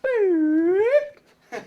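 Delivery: high, loud boop sound; a playful boop effect; cartoon boop. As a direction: pop it out like a cartoon noise.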